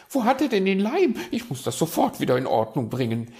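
fast, loud magpie panic; hectic, flustered. Acted. Magpie searching the raven's drawers for glue, flustered. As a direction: rush it out while hunting frantically.

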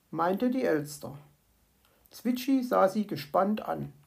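ordinary delivery, nothing marked

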